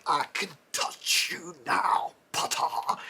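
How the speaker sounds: slow, menacing villain whisper; sinister whisper. Acted. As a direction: breathe it out like a dark wizard. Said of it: "he who must not be named was all like".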